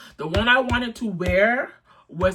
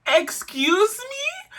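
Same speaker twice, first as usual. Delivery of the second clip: much higher and slow, incredulous; shocked disbelief; 14 semitones higher, 3 dB louder, much slower than usual. Retold or imitated. Retelling her reaction to the caller, high slow disbelief. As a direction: stretch it out in disbelief.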